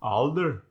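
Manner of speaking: "alder," with open ah; Scottish ah for O. Shown as a demonstration. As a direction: say older as "ahlder" for "alder,".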